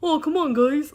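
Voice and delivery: lightly voiced goofy cartoon character. Vlogger contrasting Goofy with Daisy, light cartoon impression. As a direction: raise the pitch a little for a dopey cartoon line. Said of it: "you don't have the goofy".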